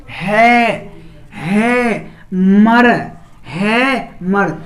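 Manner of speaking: हैमर split as है and मर with pauses; syllable by syllable. Shown as a demonstration. Teacher splits हैमर into syllables for students. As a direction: break हैमर into है, मर, है, मर. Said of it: "हैमर उच्चारण कीजिए".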